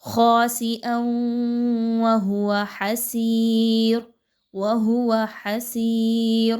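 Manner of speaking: حسیر with a soft ح from the mid throat, slow. Shown as a demonstration. Teacher demonstrates the soft ح to students. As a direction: gently recite حسیر, light throat ح. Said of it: "کیسے".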